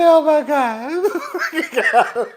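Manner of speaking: very slow, high laugh-cry; laughing through tears. Real, not acted. Laughing at herself mid-cry on camera. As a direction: let a laugh break through the crying.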